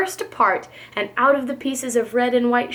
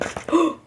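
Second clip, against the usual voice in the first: gasp; 4 semitones higher than usual. A narrator's reading. Horton hears egg hatching.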